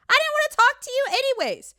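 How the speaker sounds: hammed-up huffy brush-off; pouty sulk. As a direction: flounce off like a sulking kid. Pouty end of the mock rant.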